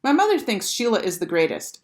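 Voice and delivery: usual speaking voice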